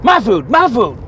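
loud possessive outburst, lightly voiced; insistent, loud retold claim; homeless woman snatching food. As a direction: imitate someone guarding a grabbed tray. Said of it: "and she's like".

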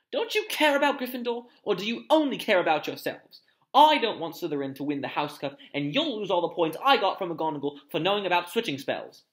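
loud, high-pitched telling-off; indignant scolding. A narrator's reading. Hermione berates the boys over house points, indignantly.

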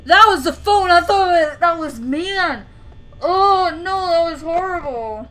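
high, loud cry of shock; real shocked yell after the phone rings; startled outburst. Real, not acted. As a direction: blurt it out still jumpy from the ring.

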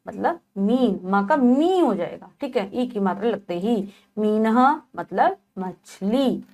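ordinary talk

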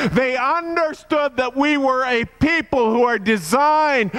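high, loud, ringing preacher's cry; full-force preaching. Acted. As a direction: lift the congregation with a soaring call to worship. Preacher at climax of sermon.

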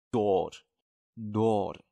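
dor said slowly and drawn out, twice; slow model pronunciation. Shown as a demonstration. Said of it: "经常有说的一个词". (慢速示范读dor)

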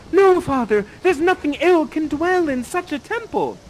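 high, loud dramatic plea; Miranda protesting. Acted. Miranda defending Ferdinand to her father, high protest. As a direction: plead up high, with force. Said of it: "And she says".

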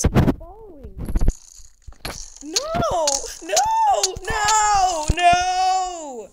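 high, loud panicked screaming; panicking gamer. Real, not acted. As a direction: scream while fleeing a mob. Chased by a husk.

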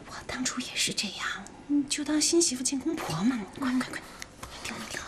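unvoiced whisper at her ear; urgent whisper, hurrying her along; whispered advice.

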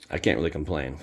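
normal talking voice

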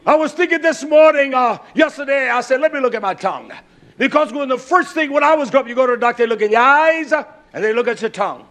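loud sermon storytelling; loud preaching. Acted. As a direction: keep the pulpit volume up. Preaching volume carried on.

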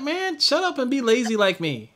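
high, laughing teasing voice; mock Till voice. Retelling Till telling him to be lazy, high laughing mimicry. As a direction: laugh through a lazy friend's taunt.

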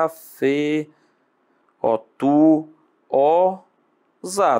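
a-fe-tu-o-za broken into separate beats; syllable by syllable. Shown as a demonstration. Teacher splitting "afetuosa" into syllables for the class. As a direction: spell out "Afe... tu... za" one syllable at a time. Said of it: "Como é que separa afetuosa?".

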